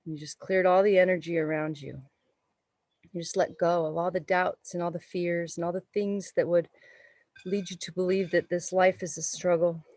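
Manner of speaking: calm, unhurried meditation voice; guided meditation. Acted. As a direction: guide the breath gently. Leads a yoga meditation.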